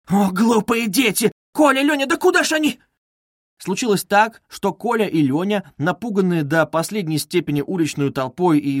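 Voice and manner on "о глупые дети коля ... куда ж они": high, alarmed calling, climbing; frightened cry. A narrator's reading. Mother calling her runaway children, frightened. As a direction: cry after them, pitch climbing high. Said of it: "вскрикнула она вдруг в испуге".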